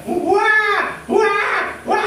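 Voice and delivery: high mock baby crying ua ua; imitated baby wail. Preacher imitates a baby crying for a midday bath. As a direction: wail like a hot, fussy infant. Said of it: "ellos sienten la calor".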